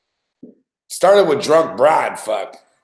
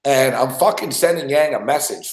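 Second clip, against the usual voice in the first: usual speaking voice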